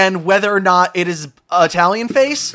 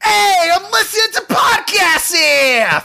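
mock New Jersey accent; 9 semitones higher than usual. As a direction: bellow it in a hammed-up Jersey accent. Returns to the hammed-up Jersey character.

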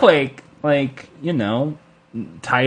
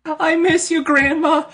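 mock tearful goodbye; 12 semitones higher than usual. Mock sentimental farewell.

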